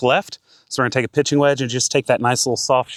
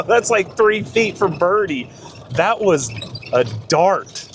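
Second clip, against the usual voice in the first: higher, slow, thrilled; excited; 7 semitones higher, slower than usual. Real, not acted. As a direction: relish the words, still buzzing. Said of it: "I'm getting too excited".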